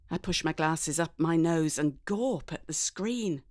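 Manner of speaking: ordinary delivery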